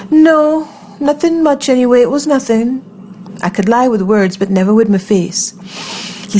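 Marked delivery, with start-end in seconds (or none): 0.1-2.8 high-pitched, too-light denial; hastily covering up, pitched high; polite fib. A narrator's reading. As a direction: brush it off in a high voice. Covering fear with a polite lie.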